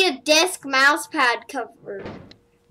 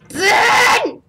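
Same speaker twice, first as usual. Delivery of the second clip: loud high shout; shouting Ben; 9 semitones higher, 10 dB louder than usual. Real, not acted. Gamer shouting at Ben in the game, loud high call. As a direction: bellow the name across the room.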